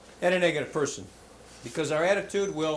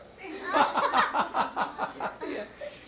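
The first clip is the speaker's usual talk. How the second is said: high breathy laugh; 12 semitones higher than usual. Real, not acted. Speaker laughs at punchline.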